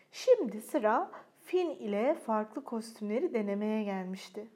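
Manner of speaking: plain reading voice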